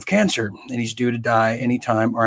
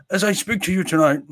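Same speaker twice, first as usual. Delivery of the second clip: higher-pitched mock British accent; joking British impersonation; Boris Johnson accent; 5 semitones higher than usual. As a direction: put on an amateur British politician's accent. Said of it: "is that my Boris Johnson accent".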